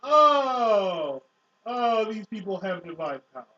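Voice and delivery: normal talking voice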